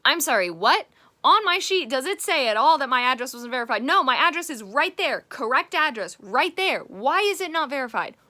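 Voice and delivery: loud, high exasperated rant. Real, not acted. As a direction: vent in loud disbelief. Ranting about unverified address.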